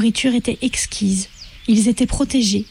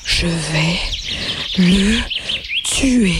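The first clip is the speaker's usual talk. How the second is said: low, breathless, half-choked threat; panting voice; 3 semitones lower, much slower than usual. Acted. A wounded rabbit panting a threat at his enemy. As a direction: gasp out the words between breaths. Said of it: "une voix haletante".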